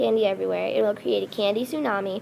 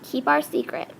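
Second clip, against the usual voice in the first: slightly raised parting call, pitched higher; narrator's restrained yell, higher in pitch; mild yell; 4 semitones higher than usual. A narrator's reading. Reminding a departing friend of the secret, mild higher yell. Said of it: "I yelled".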